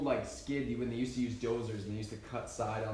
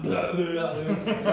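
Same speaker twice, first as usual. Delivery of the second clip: laughing mock; 4 semitones higher, 8 dB louder, much slower than usual. Mocking other sound.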